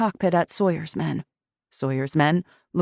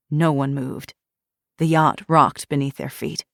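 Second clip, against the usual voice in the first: ordinary delivery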